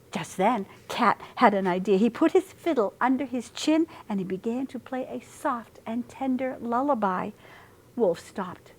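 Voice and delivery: plain reading voice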